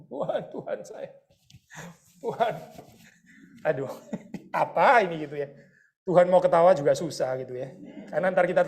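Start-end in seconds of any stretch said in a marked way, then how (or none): 0.1-5.2 playfully overacting a whiny complaint; mock drama queen whine. Preacher mimicking a believer whining to God, mock drama queen. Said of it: "Kita jadi drama queen di hadapannya Tuhan".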